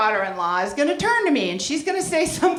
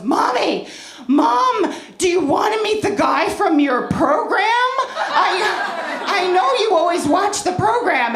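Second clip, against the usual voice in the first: son's excited voice; 6 semitones higher, 3 dB louder than usual. Retold or imitated. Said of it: "he goes".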